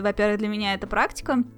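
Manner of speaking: normal talking voice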